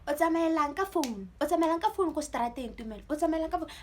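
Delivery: high, loud mock telling-off; imitated mom's scolding. Mom questioning her child about the phone, mock scolding. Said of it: "they started scolding at me".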